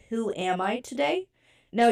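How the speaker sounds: usual speaking voice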